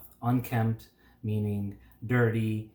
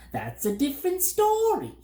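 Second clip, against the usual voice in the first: very high, friendlier bird voice; amiable bird; 4 dB louder than usual. A narrator's reading. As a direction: brighten up like a sly bird. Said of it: "a bit more amiably".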